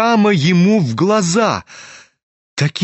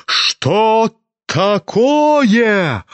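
higher, very slow, drawn out; disbelieving; 5 semitones higher, much slower than usual. A narrator's reading. Лизавета Прокофьевна не верит ушам: протяжно, выше. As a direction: stretch it in shocked disbelief. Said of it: "Она ушам своим не хотела верить".

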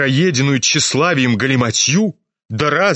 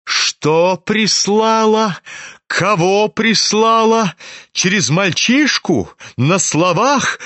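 higher, slow, urgent questions; a shaken mother interrogating the prince, higher and slow; exclaiming; 7 semitones higher, slower than usual. A narrator's reading. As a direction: demand each detail, worked up and pressing. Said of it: "воскликнула она вдруг опять".

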